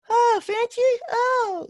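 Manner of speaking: very high, gushing mock voice of his mother; high squealing imitation of mom; fawning mom imitation. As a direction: coo the name like a smitten old lady. Mimicking mom fawning over man.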